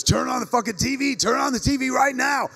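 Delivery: high, urgent yell down the phone; imitated scream. Friend calls about the TV, screaming. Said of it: "called and screamed at me to turn on the T V".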